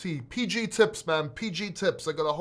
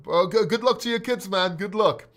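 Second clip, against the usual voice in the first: usual speaking voice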